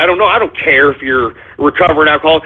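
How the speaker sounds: ordinary delivery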